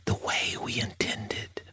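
hushed whisper for a quiet cabin. Acted. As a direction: drop to a soft whisper. Ad voice drops to whisper.

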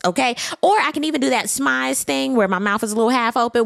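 ordinary talk, nothing marked

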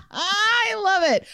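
high, slow squeal through real laughter; delighted laughing. Real, not acted. Delighted at the joke.

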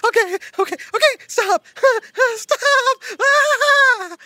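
high squealing laughs while pleading stop; giggly tickle attack. Acted. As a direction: laugh helplessly, begging it to stop.

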